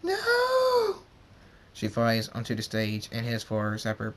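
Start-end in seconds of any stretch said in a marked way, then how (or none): 0.0-1.0 very high, drawn-out loud wail; screamed no. A narrator's reading. Fluttershy sees Zapper fallen, screaming. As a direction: scream in horror, holding the word. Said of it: "Fluttershy screams".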